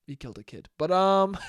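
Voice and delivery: ordinary talk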